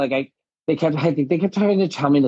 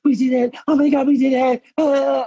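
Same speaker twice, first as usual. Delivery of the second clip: much higher and louder, a squealing cheer of victory; excited cheer; 12 semitones higher, 3 dB louder than usual. Retold or imitated. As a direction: shriek it like you just won the crown. Celebrating with Priyanka, retold.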